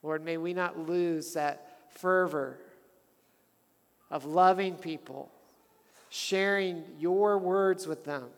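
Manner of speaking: measured, earnest prayer voice; a pastor closing in fervent prayer. Acted.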